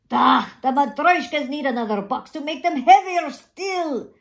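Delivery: put-on mildly exasperated old man. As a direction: throw your hands up with your voice. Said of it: "throwing up his hands in exasperation".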